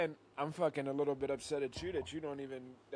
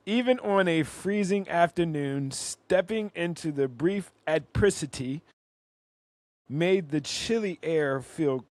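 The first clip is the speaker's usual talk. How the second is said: slow reading aloud; 3 semitones higher, 11 dB louder, slower than usual. Real, not acted. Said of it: "It says".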